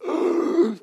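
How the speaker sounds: mock gasp. Acting shocked at kid.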